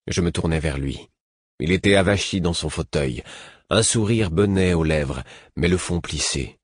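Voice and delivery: ordinary delivery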